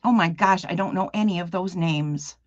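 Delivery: ordinary delivery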